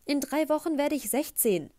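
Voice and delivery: put-on teen insisting on her age to the boy; indignant. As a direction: bristle at being called a child. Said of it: "sagte ich aufgebracht".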